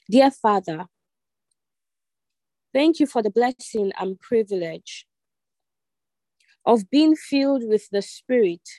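measured prayer in short phrases with long pauses; led prayer. Real, not acted. Online congregation repeating after the leader, measured led prayer. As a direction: lead the prayer phrase by phrase, pausing for repetition.

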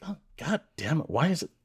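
softly grumbled mock complaint; mock annoyed colleague. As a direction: play a colleague quietly cursing to herself. Acts out annoyed colleague in meeting.

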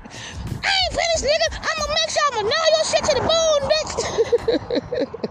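high squeaky cartoon threat; defiant little dog. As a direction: threaten like a tiny, stubborn lapdog. Biting dog answers the tiger.

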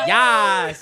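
very loud, high exultant yes; mock gleeful cheer. Podcaster imitating Cheryl thrilled by the show, gleeful yell. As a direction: belt it out with hammed-up delight.

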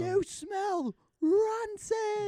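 very high, very slow mock roar; Gordon Ramsay. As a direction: stretch out the disgusted yell. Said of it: "yells at him in front".

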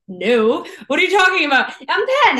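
high, loud mock protest; indignant retort. Ten-year-old answering parents, acted baffled indignation.

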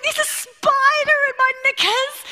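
high, loud hammed-up panic; young wife shrieking to her husband, mock panic; retold scream. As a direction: wail it like a new bride in a spider fright. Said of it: "I am screaming".

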